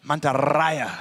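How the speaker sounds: "Mantaraya" with a trilled R; rolled R. Shown as a demonstration. Caster showing co-caster who can't roll R's, rolled R in "Mantaraya".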